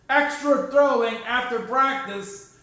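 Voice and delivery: ordinary talk, nothing marked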